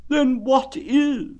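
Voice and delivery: very slow, high, drawn-out mournful donkey; gloomy Eeyore. Acted. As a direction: drag it out like a sad old donkey. Eeyore asks.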